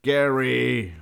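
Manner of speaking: very loud, high, drawn shout; roar. A narrator's reading. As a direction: roar the name out after him. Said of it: "brüllte er".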